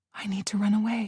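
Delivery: hushed, unvoiced and urgent; desperate whisper. A narrator's reading. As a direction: breathe it out in secret.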